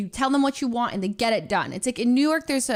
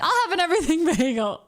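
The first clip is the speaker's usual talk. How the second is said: laughing; 6 semitones higher, faster than usual. Retold or imitated. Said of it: "He's like".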